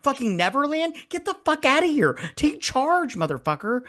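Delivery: high-pitched incredulous rant; hammed-up telling-off, voice soaring high; exasperated scolding. Telling off Tom for letting Gemma handle this, exasperated.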